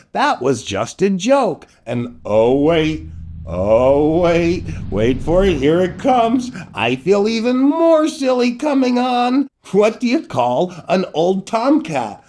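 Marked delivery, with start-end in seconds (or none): none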